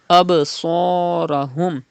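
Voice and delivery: Absarahum slow with bounce on ba; ba qalqala. Shown as a demonstration. Teacher models qalqala on ba for students, slow bounce. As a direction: rebound the ba, slowly. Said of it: "Do qalqala in ba".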